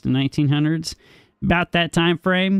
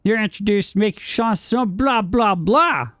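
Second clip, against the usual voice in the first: higher, run-on recital of a definition; pointed, higher-pitched reading aloud; 7 semitones higher than usual. Acted. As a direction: recite it like an encyclopedia entry, pitched up. Reads out a dictionary definition.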